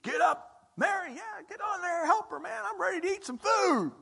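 high, breezy joking voice; mock casual Jesus. Imagined Jesus tells Mary to help, high joking voice. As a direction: play a jokey, hungry Jesus nudging Mary. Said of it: "What's Jesus gonna do?".

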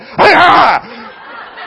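high, loud hammed-up yell at the dogs; a dad yelling to scare off yappy dogs, high loud hammed-up shout; mock karate yell. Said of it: "Like that".

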